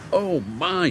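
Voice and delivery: a touch of wonder, pitch lifted; excited. A narrator's reading. Mavis marvels at the new fuel, excited.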